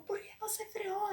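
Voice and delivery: desperate high cry from behind the wheel; frantic yelling. Retold or imitated. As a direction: scream it in high-pitched disbelief. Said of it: "Eu gritando dentro do carro".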